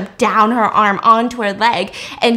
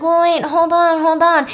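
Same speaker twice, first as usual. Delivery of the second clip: mock protest; 6 semitones higher than usual. Acts woman protesting during assault.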